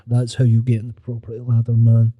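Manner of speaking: mock guru advice.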